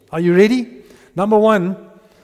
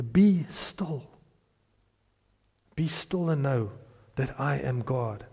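"Be still" whispered, then fading softer; hushed whisper; 3 semitones lower, 7 dB quieter than usual. Shown as a demonstration. Preacher contrasting busy with stillness, hushed whisper. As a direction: breathe the psalm out in a hush. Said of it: "No, no, he said".

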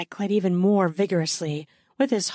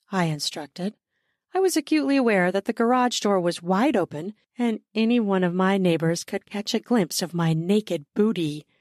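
ordinary delivery, nothing marked

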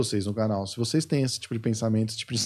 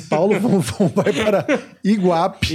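laughing; 5 semitones higher, 4 dB louder, slower than usual. Real, not acted. Laughing while teasing partner.

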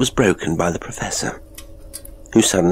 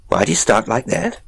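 quick suspicion; 5 semitones higher than usual. A narrator's reading. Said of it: "with a quick suspicion".

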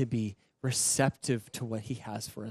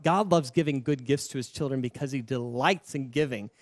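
normal talking voice

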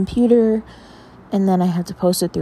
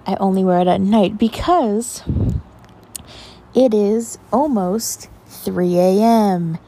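normal talking voice

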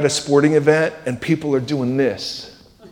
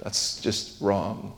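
inner doubting voice; 7 semitones lower, 9 dB quieter than usual. Retold or imitated. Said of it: "you're thinking".

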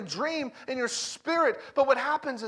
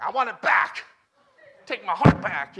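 loud, imitated girl's demand; mock angry demand; 9 dB louder than usual. Girl demanding her friendship necklace back, mock angry. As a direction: act out a child's outburst, punched loud.

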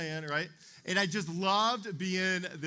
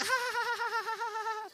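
a high teasing shriek; high gleeful squeal. Retold or imitated. Little sibling squealing at his brother's ruined fort. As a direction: squeal like a delighted pest. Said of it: "And I'm like".

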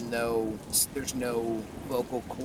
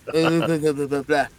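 put-on Russian accent; 6 dB louder than usual. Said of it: "I'm doing a Russian accent now".

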